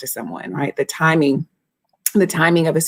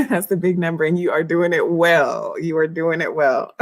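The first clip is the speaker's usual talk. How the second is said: ordinary talk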